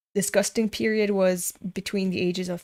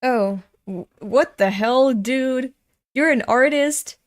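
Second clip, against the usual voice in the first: loud, higher disbelief; outraged; 4 semitones higher, 5 dB louder than usual. Real, not acted. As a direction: call it out, appalled. Outraged reaction to comic.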